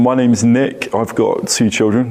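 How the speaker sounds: ordinary talk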